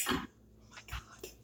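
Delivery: hushed, amazed whisper; awed whisper. Real, not acted. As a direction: barely breathe it out in awe. Overwhelmed by the food.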